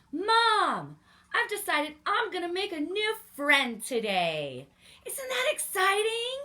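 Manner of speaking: put-on high, loud eager child voice; little bear girl bubbling over with excitement; excited little girl. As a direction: burst out with the big news like a thrilled kid. Lucy tells Mom her plan.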